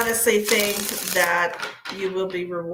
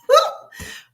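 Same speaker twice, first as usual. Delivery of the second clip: squealing laugh; 7 dB louder than usual. Real, not acted.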